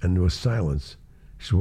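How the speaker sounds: ordinary talk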